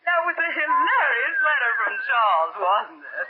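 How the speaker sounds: faking a friendly chat under duress; forced merriment. Acted. Hostage chatting as if with an old friend, forced merriment. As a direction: pretend to be amused, dropping low at the end. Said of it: "you will laugh and talk as if".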